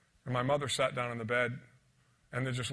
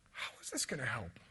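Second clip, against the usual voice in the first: hushed inner voice; whispered thought; 2 semitones lower, 7 dB quieter than usual. Retold or imitated. Boy handed a sandwich, whispering his doubt. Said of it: "I remember thinking at six and a half".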